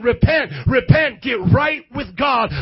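loud, slow call to repent; shouted repent. Acted. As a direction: bellow the call out slowly. Preacher calling out repent.

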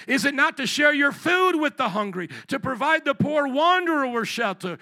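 high, loud, forceful Bible reading; impassioned scripture declamation; preaching scripture. Real, not acted. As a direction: drive each phrase home from the pulpit.

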